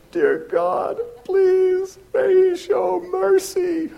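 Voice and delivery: pleading high and slow, hands clasped; desperate prayer. Retold or imitated. Pulled over in his car, begging God in a high slow plea. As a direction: beg God for mercy in a thin high voice. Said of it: "I remember in my car going".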